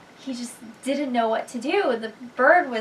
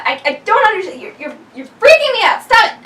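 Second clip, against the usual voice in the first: higher, louder, fast and panicky; freaked-out bird; 9 semitones higher, 8 dB louder, faster than usual. Acted. Bird freaking out at the unicorn, fast panicky rising voice. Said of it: "the bird is like".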